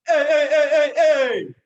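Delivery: loud, high chanted "hey"s; rhythmic yell leader chant; sophomore wildcat yell. Shown as a demonstration. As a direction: shout each hey out hard. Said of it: "show you what the sophomore Wildcat looks like".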